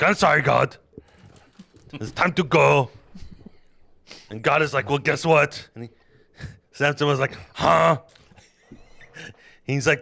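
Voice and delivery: loud, high comic Bible retelling; boisterous mock-epic narration; hammed-up storytelling. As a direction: tell it like a rowdy campfire tale.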